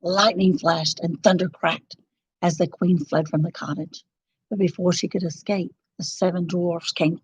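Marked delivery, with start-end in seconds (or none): none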